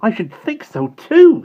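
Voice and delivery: high, loud and slow with indignation; be outraged on her behalf; indignant. Acted. Simon outraged at Bonnie sweeping paths, indignant and slow. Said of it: "said Simon with indignation".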